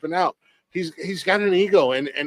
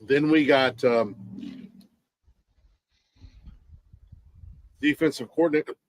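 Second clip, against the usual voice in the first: hiccuping between words; hiccups. Real, not acted. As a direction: talk through the hiccups. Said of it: "now I got the hiccups".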